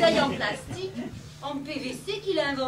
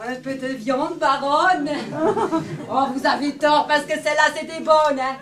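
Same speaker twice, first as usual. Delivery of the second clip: put-on drunk shouting; 9 dB louder than usual. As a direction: holler like a drunk at a market. Drunk woman auctioning meat. (crier comme une ivrogne)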